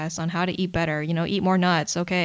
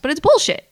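louder, higher punch on "bullshit"; emphatic; 9 semitones higher, 7 dB louder than usual. Real, not acted. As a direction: hit "bullshit" hard and high. Indignantly repeats her verdict.

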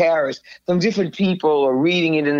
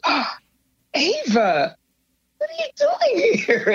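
breath in, then very high astonishment; astonished greeting; slower than usual. Retold or imitated. David turns and sees her, astonished. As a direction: imitate amazement, voice leaping way up.

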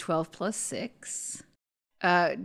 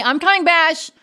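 loud, higher reply; calling back; 9 semitones higher, 9 dB louder than usual. Acted. Tira answers Bash's call from the hallway, loud and higher. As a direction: shout back that you are on your way.

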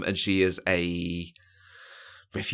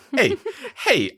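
"Hey, hey" yelled much higher in a mock New York accent, laughing; 5 dB louder than usual. Host laughs through a mock New York accent "hey". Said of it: "in New York accent".

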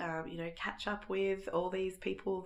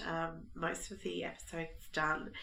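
ordinary talk, nothing marked

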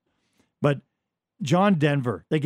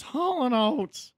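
high, drawn-out mock announcement; someone announcing in a meeting; 9 semitones higher, much slower than usual. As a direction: stretch it out slowly, pitched way up. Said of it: "someone goes".

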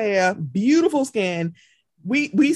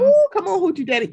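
high, loud playful catcall; excited catcall; 10 semitones higher, 5 dB louder, faster than usual. Retold or imitated. Cheering the guy in short shorts, excited catcall. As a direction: whoop it like cheering someone on.

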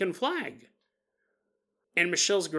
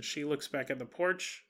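ordinary delivery

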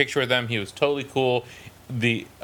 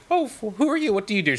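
high, overly bright chirp; retold fake cheer; 9 semitones higher, faster than usual. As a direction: put on eager politeness toward a stranger. Retold self greeting the actress.

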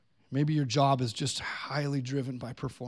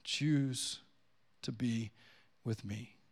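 gentle Jesus; 5 dB quieter than usual. Acted. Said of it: "that he would say".

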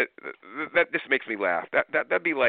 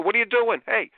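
high, loud scolding shout; yelling at kids; 14 semitones higher, 4 dB louder than usual. Retold or imitated. Parent yelling at kids acting out. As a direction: snap at the kids. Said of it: "You're yelling at burgers".

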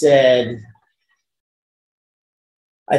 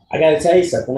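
ordinary delivery

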